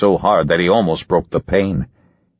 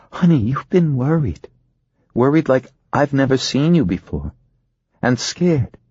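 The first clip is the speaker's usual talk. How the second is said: higher voice of a worried wife; concerned wife; 7 semitones higher than usual. A narrator's reading. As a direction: address the husband as his concerned wife, pitched up. Narrator plays worried wife.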